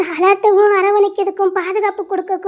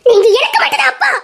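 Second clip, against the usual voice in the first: louder tearful outburst; anguished cry; 6 dB louder than usual. Acted. Daughter tearfully shouts that he is her only father. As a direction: cry it out at him.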